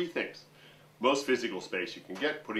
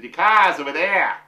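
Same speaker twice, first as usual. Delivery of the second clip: imitating a Boston accent; 7 semitones higher, 12 dB louder than usual. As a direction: throw it out like a Boston garage attendant. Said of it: "And he said".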